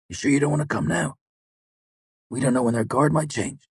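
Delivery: a jittery fighter counting under his breath; nervous soldier voice. A narrator's reading. Martin voiced by narrator.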